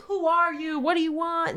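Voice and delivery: high-pitched pleading call to a spirit; mock ghost-questioning. Family asks the ghost who it is, high mock call.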